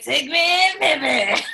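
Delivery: high, loud joke voice; hammed-up playful shout; mock swagger. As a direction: belt it out as a teasing put-on. Listener jokingly voices the changed husband.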